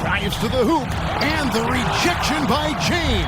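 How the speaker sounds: fast sports commentary. Acted. Block called in play-by-play.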